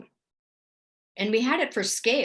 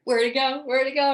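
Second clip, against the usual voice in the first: higher, louder repeated question; bewildered surprise; 5 semitones higher, 5 dB louder than usual. Retold or imitated. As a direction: reenact startled searching for something vanished. Retelling surprise at vanished UFO.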